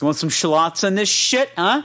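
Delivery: lightly voiced Jersey guy offering shallots; Jersey accent. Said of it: "they don't call".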